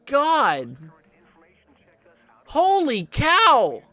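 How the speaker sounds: loud, high, drawn-out disbelief; stunned, slow amazed cries; shocked exclamation. Real, not acted. Shocked by a storm report.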